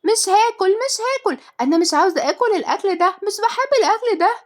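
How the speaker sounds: put-on very high, loud child voice; shrieky tantrum at the top of the range; yelling little girl. A narrator's reading. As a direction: refuse food like a bratty child. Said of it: "وعمالة تزعق".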